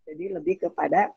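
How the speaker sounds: ordinary talk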